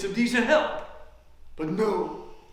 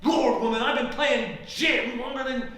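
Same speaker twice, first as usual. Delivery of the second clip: loud, higher, hurried at first; indignant rant; 4 semitones higher, 4 dB louder than usual. Acted. Player defends his gin experience to opponent, indignant. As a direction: bristle and defend your skill.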